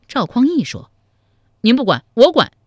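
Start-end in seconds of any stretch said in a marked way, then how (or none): 1.6-2.5 loud, higher boastful vow; bold declaration. Acted. Young brother volunteering to deal with them, loud bold vow. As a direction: take it on with bravado.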